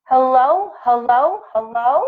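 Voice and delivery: hesitant, rising hellos; mild nervous greeting. A narrator's reading. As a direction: call out unsure of the stranger. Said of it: "Jitterbug said nervously".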